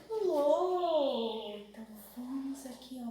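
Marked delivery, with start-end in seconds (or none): 0.1-1.5 put-on lo stretched and said loudly for kids; syllable called out. Shown as a demonstration. Teacher calling out lo to little pupils, loud. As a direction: call out lo clearly to the class. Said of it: "começa com a sílaba".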